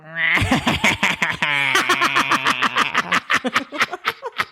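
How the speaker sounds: long high cackling villain laugh; unhinged evil laugh. Pretend. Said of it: "you do your evil laugh".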